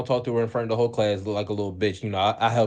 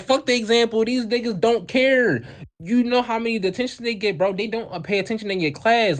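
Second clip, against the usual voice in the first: heated protest; 9 semitones higher than usual. Retold or imitated. Arguing with teacher.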